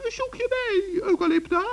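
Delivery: very high, chirpy little gnome; a tiny forest gnome, all innocence; high gnome voice teasing the witch. Acted.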